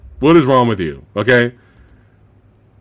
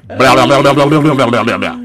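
fast, babbling tongue-flapping blahs; wagging-tongue noise; 4 semitones lower, faster than usual. Acted. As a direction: slobber noisily like a monster's flailing tongue. Imitates the monster's tongue attack.

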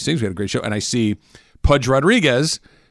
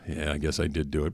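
low, soft, reluctant admission; sheepish mock confession; 8 semitones lower, 9 dB quieter than usual. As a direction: act out a caught kid owning up quietly. Child confessing in retold story.

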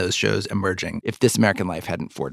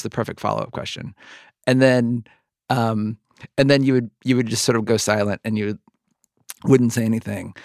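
ordinary talk, nothing marked

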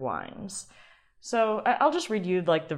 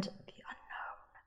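dramatic whisper; 22 dB quieter, much slower than usual. Pretend. Dramatic whisper reading the website.

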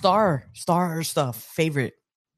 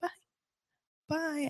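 much higher, light parent voice; bright high mom-to-kid goodbye; sing-song goodbye to child; 13 semitones higher, 6 dB quieter than usual. Retold or imitated. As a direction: shoo her into class sweetly, pitched way up. Mom sending daughter into class.

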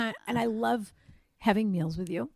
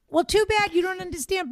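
higher, mock teenage backtalk; teenage defiance; 8 semitones higher than usual. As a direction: act out a defiant teenager's comeback. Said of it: "devolve into a teenage position".

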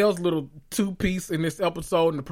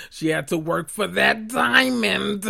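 slower, repeating the joke while laughing; giggling at her own joke on diamond; laughing through it; 4 semitones higher, slower than usual. Real, not acted. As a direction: crack yourself up on the repeat.

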